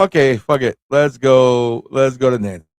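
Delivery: ordinary delivery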